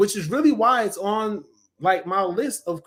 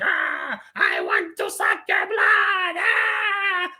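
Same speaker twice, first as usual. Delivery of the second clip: very high, drawn-out screamed vampire cry; Gilbert Gottfried's vampire; 13 semitones higher, much slower than usual. Retold or imitated. Reviewer imitating Santa turned vampire, high screamed voice. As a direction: imitate a screeching cartoon vampire, slowly. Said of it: "hear him doing the vampire noise".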